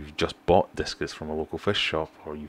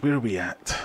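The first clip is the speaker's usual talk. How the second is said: lightly voiced silly repeat, a bit higher; playful put-on voice; 5 semitones higher than usual. Streamer repeating himself to chat, playful put-on voice. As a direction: toss the question again in a goofy voice.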